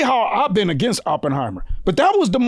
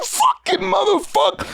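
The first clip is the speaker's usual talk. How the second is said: admiring exclamation; 9 semitones higher than usual. Retold or imitated. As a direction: yell it in gleeful admiration. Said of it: "I said".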